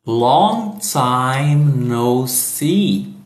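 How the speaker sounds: stretched out for learners to copy; very slow model. Shown as a demonstration. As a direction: draw every word out slowly. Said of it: "vamos começar repetindo". (bem devagar, para repetir)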